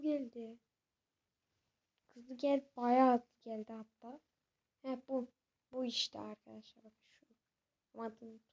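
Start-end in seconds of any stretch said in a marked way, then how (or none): none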